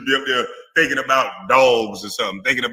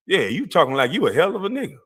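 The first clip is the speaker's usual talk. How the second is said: ordinary talk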